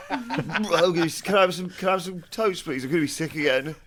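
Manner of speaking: high, pleading whine through laughter; whiny sick teen. Pretend. Hungover teenager begging mum for toast, high and whiny. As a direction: beg for toast like a queasy teenager.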